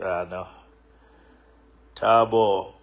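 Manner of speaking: normal talking voice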